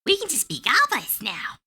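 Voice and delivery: high cheerful goblin voice; high happy goblin chorus; delighted goblins. Retold or imitated. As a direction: goblins celebrating freedom. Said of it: "goblins everywhere were like".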